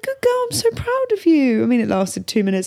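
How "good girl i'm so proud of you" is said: very high, gushing praise; praising child. Retold or imitated. Mother praising daughter for calming down. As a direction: sing out how proud you are. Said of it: "I was like".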